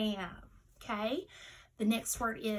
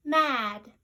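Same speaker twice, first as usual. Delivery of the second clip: "Mad" called out louder with a clear short a; word called out; 7 dB louder than usual. Shown as a demonstration. Teacher calling out the last test word. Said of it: "Last word, friends".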